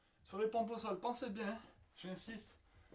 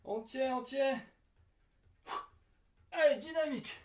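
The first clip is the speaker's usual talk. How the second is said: energetic coaching call; 4 semitones higher, 3 dB louder than usual. Real, not acted. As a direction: urge them to hold on. Coach pushing the final set.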